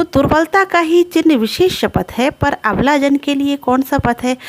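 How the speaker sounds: high-pitched poetry recital; verse recitation. Acted. Teacher repeating the verse for students, high recitation.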